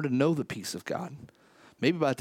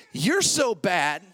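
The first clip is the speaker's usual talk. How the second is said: slow, drawn-out playful teasing; teasing client; 4 semitones higher, 4 dB louder, much slower than usual. Retold or imitated. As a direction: stretch it out like a friend mocking you. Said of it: "He said".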